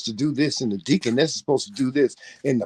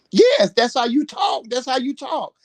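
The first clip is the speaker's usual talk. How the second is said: excited shout; 10 semitones higher than usual. Real, not acted. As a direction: shout yes like you mean it. Emphatic agreement with co-host.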